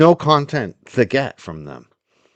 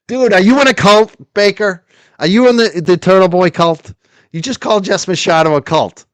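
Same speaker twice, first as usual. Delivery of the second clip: taunting; 3 semitones higher, 3 dB louder than usual. Real, not acted. Taunting Baker.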